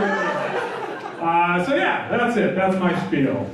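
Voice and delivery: usual speaking voice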